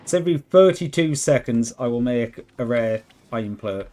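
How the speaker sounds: usual speaking voice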